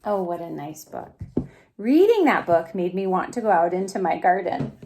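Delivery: ordinary delivery, nothing marked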